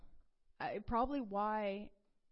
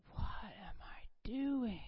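slow breathy half-whisper, close to tears; retold dismay, dragged out in a tearful hush; horrified at herself; slower than usual. Horrified at herself after yelling.

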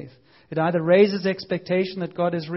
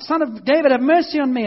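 loud, high pleading cry; shouted plea; 6 semitones higher, 5 dB louder than usual. Retold or imitated. Blind beggar shouting to Jesus over the crowd, loud high plea. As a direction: shout for mercy across the crowd. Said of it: "what does he shout".

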